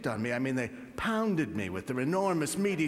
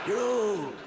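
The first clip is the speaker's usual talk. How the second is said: put-on louder pitched-up kid taunt on old; weird kid voice; 6 semitones higher, 5 dB louder than usual. Little son taunting his father, in a loud weird kid voice. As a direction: bark it like a cheeky little boy. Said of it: "It's got a weird voice".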